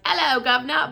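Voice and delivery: hammed-up Cockney greeting, mocking the narrator; mock Cockney accent. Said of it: "like, a weird Cockney accent or something".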